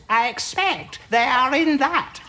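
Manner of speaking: secretary voice. A narrator's reading. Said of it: "jerked the secretary".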